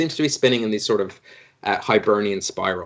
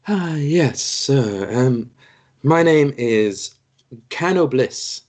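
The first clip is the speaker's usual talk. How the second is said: lightly voiced cleric; 3 semitones higher than usual. GM voices cleric Cano Bliss.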